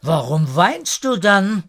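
put-on lifted childlike questioning; calling back. A narrator's reading. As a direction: call back in puzzlement. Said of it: "riefen gemeinsam zurück".

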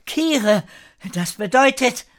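put-on high-pitched boy's answering call. A narrator's reading. Kire calls his name back to the girl, high call.

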